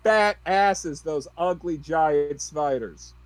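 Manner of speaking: loud, high yell over the shoulder; big loud character yell; yelling back. Acted. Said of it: "Belle yells back out".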